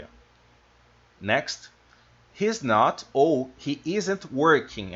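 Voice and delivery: ordinary delivery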